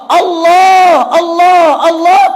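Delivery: high, loud repeated cry. Retold or imitated. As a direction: call out desperately. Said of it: "dia lari menyebut nama Allah". (seruan keras memanggil Allah)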